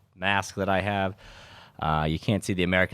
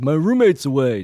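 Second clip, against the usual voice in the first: higher, louder mock-casual friend; 8 semitones higher, 7 dB louder than usual. Mocking a friend hiding his parents' house, higher and louder. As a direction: ham up his friend's cover story. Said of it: "he tries to make it seem like".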